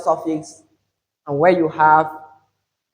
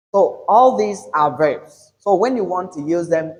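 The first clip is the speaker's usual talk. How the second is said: normal talking voice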